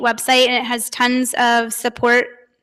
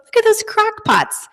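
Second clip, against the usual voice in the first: higher, lightly voiced girl; a hint of an excited kid; daughter's voice; 10 semitones higher than usual.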